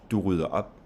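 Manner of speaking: only final "op" stressed, "Du rydder" light. Shown as a demonstration. Teacher showing final-word stress, weight only on "op". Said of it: "only have stress on the final word".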